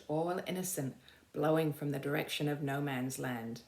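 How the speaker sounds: usual speaking voice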